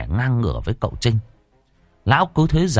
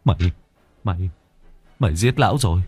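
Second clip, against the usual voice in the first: horrified; 3 semitones higher, faster than usual. Acted. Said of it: "Cậu Trinh tái cả mặt".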